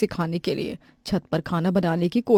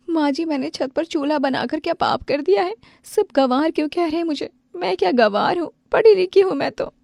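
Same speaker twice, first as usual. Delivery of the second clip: crying bride; 9 semitones higher than usual. Acted. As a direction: pour out the hurt through tears. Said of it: "वो घर आकर रोने लगती है". (रोती हुई बहू की ऊँची फ़रियाद)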